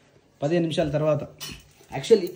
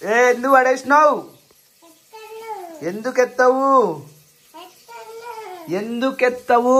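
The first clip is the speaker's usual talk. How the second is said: loud scolding; 7 semitones higher, 3 dB louder, slower than usual. Real, not acted. Scolding child over tamarind.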